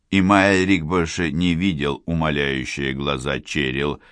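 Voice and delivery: ordinary delivery, nothing marked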